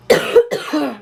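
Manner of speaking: a sick, chesty cough; real cough. Real, not acted. Sick vlogger coughing mid-explanation, a real cough. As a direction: cough it out, under the weather. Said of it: "if I'm also very raspy and coughing".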